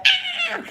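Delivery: very high mock scream; retold scream. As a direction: squeal like you're scared of the bug. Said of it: "I'ma scream the whole time".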